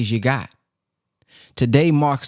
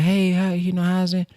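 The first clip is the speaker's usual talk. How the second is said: mild excited greeting; 4 semitones higher than usual. Retold or imitated. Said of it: "she was excited".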